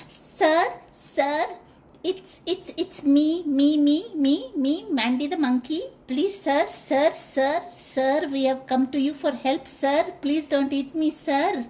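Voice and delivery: high, stammering, pleading monkey; monkey begging the lion not to eat him; nervous monkey. A narrator's reading. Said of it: "And the monkey said".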